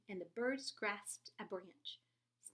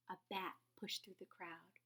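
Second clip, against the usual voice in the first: usual speaking voice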